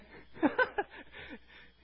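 high laugh; laughing after the amen. Real, not acted. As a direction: burst into a high chuckle.

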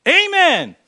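high, loud drawn-out amen; exaggerated pew-voice amen; mock churchgoer amen. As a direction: sing out a hearty congregational amen. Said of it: "Most of us in our culture today would go".

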